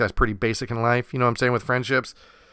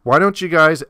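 ordinary talk

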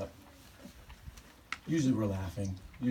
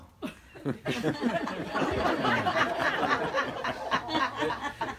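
bursting, high-pitched laughter; loud high laugh; 5 dB louder than usual. Real, not acted. Laughing at Natasha's funny request.

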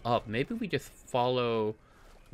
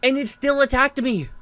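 agitated; 10 semitones higher, 8 dB louder than usual. Real, not acted. Complaining about monster.

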